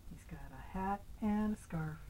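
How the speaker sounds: plain reading voice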